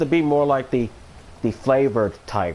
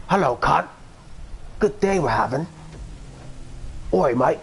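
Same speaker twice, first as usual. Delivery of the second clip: higher-pitched mock Australian greeting; Australian accent; 4 semitones higher than usual. Greeting typical neighbors on a day out, mock Australian accent. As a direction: put on a rough-and-ready Australian accent. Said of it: "Not sure if that's an Australian accent".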